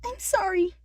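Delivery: high, small apology; high girl voice. A narrator's reading. Brittany apologizing.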